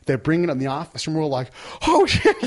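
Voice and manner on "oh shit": high, loud yelp of alarm; retold panic. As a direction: act out everyone spotting a live grenade. Retold panic at live grenade.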